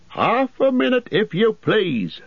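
high, hurried call over the shoulder; a busy landlord calling back, pitched high; innkeeper calling out. A narrator's reading. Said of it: "shouted the man over his shoulder".

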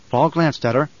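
plain reading voice

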